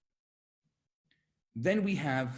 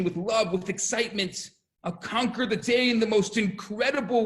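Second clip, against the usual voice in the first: raised, rousing declaration; fervent determination; 6 semitones higher, 4 dB louder than usual. Retold or imitated. Little Moses inside us greeting the day, fervent determination. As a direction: lift it into a passionate pledge.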